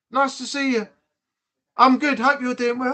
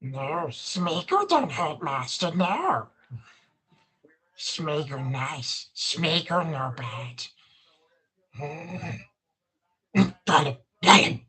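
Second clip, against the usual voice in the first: low, slow pleading Smeagol whine; 5 semitones lower, 5 dB quieter, slower than usual. Acted. Streamer keeps going as Smeagol, low and slow, begging master. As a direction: beg your master in a broken creature voice. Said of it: "Gollum voice?".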